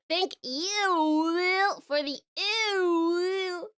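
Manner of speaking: slow disgusted drawl; stretched-out mock disgust; drawn-out ew.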